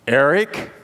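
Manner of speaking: a low firm warning on the name; parent's loaded warning; warning name call. Retold or imitated. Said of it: "You know what that means?".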